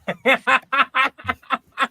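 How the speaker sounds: high, loud run of laughter; staccato high-pitched giggling fit; laughing. Real, not acted. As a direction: burst into a high, rapid laugh. Player laughing at own joke.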